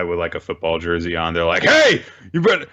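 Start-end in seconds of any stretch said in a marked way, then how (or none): 1.6-2.7 high, loud bully challenge; bully confronting. Retold or imitated. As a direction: bark at him like a bully. Bully accosting narrator.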